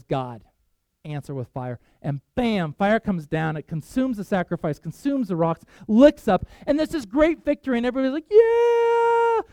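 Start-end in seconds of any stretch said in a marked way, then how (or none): 0.0-1.8 low, soft, simple plea; understated prayer. Retold or imitated. Elijah looks up to call on God, understated prayer. 8.3-9.4 high, drawn-out crowd cheer; victory cheer. Retold or imitated. Everybody celebrates the great victory, imitated cheer.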